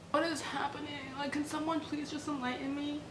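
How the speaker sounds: small, high, softened voice; bewildered plea. Real, not acted. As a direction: plead quietly and high for an explanation. Bewildered plea while reading.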